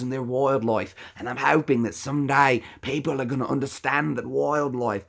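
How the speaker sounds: earnest Australian wildlife-conservationist impression; Steve Irwin. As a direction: passionate broad Aussie host. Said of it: "that's Steve Irwin".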